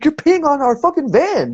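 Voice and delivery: very high-pitched mock outrage; retold indignant protest. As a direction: act out an incredulous complaint to a drunk. Retold shouting match over the van.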